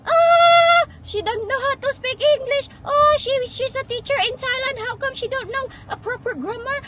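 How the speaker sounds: loud, high-pitched, hammed-up outrage; mock judgmental commenter. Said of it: "They still gonna say". (pabirong panggagaya sa mga mapanghusga, malakas at matinis)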